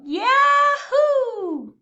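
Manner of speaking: huge high whooping yahoo; rebel yell. A narrator's reading. Armadillo landing in the hayloft, loud high rebel yell. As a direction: let it rip like a cowboy. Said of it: "he let out a rebel yell".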